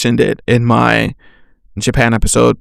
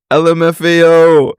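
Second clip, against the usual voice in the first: raised, sing-out letter by letter; hammed-up exclamation; 4 semitones higher, 3 dB louder than usual. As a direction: spell it out high and held. Acts out own exaggerated texted reaction.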